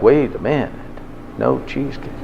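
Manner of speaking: breathy whispered panic as it dawns; a near-whisper of sudden dread; hushed panic. Retold or imitated. Imitating the dieter's inner panic, whispered.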